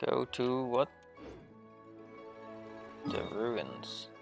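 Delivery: usual speaking voice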